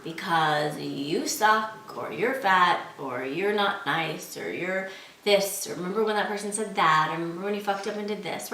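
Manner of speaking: low, drawn-out put-down from inside the head; inner monster voice. Acted. Inner voice doubting someone who feels good, low monster put-down. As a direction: sneer it low, stretching the first words. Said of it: "some sort of crazy monster voice".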